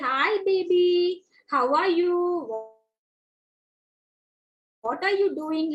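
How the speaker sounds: put-on slow, drawn-out baby-directed voice; talking to baby. Shown as a demonstration. Teacher models greeting a baby, slow baby talk. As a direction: stretch the words out for a baby. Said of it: "when you are talking to the baby".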